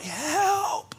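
small, high, faint plea; a weak, breathless little help; barely voiced plea for help; tiny cry for help. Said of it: "it probably only came out like".

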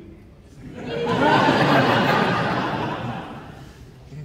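breathy laugh. Real, not acted. Actor mocking his own naivety to fans, breathy laugh. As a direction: laugh at yourself.

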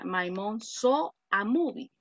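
slow, clear model sentence. Shown as a demonstration. Teacher slowly giving the corrected translation. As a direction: lay it out carefully for the class. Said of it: "Mi mamá vio una película".

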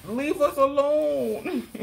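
drawn-out dismissal. Real, not acted. Dismissive sign-off.

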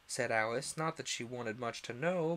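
ordinary delivery, nothing marked

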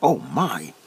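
ordinary talk, nothing marked

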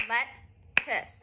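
lettuce split into two soft beats; syllable by syllable, let-tuce; soft clapped syllables. Shown as a demonstration. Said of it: "You're gonna do it".

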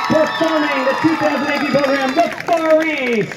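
stadium announcer. Real, not acted. Announcer presenting the home show band to the crowd, ringing announcement. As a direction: build up the big introduction, stretching the final name.

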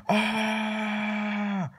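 long, high held mock scream. Child screams in the waiting line, shrill play scream. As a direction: shriek on one high steady note. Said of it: "who's screaming".

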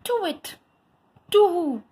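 high hooting owl call. A narrator's reading. The owl on the barn roof calling out, high hoot. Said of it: "hooted the owl".

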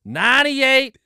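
high, loud American drawl at the gas station; American accent. Pretend. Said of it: "you're an American man that's walking into a gas station".

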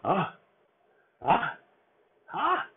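rising high gasps before a sneeze; ah, ah, ah climbing toward a sneeze; sneeze buildup. Acted.

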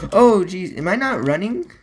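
real startled reaction, pitched well up; startled alarm. Real, not acted. Gamer finding they may not be running, startled. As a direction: burst out high and alarmed. Startled by trouble moving in game.

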